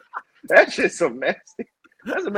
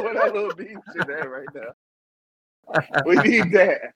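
ordinary delivery

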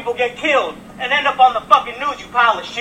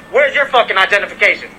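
ordinary talk, nothing marked

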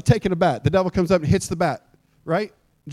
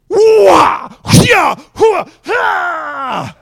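loud, high kung-fu yells; hammed-up sword-fight shouts, the last one drawn out; martial battle cries; 10 semitones higher, 7 dB louder, much slower than usual. Mimes a sword fight with devil.

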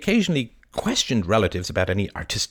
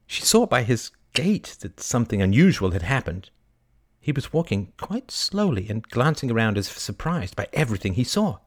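usual speaking voice

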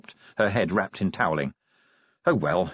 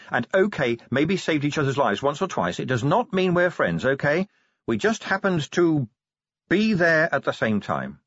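higher-pitched insistent speech; Angua, defensive werewolf; 6 semitones higher than usual. Acted. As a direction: firmly set the terms, pitch raised. Angua sets terms with Sally.

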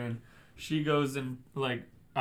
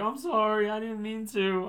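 lightly mimicked, higher-pitched apology; mock apologetic driver; 6 semitones higher than usual. Driver quoting the woman's excuse, lightly mimicked apology. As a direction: plead it higher, imitating her.

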